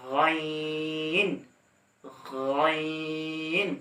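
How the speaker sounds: গইন drawn out slowly with a throaty gh; Arabic letter ghain. Shown as a demonstration. Teacher demonstrating গইন for learners. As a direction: hold গইন long from the top of the throat. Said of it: "গইন উচ্চারণ করবেন".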